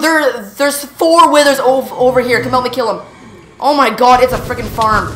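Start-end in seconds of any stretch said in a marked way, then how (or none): none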